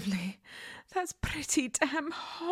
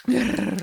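low cat growl; 9 semitones lower, 7 dB louder than usual. Acted.

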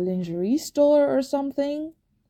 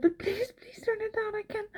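breathy pleading; 10 semitones higher, 9 dB quieter than usual. Acted. Begging, voice fading.

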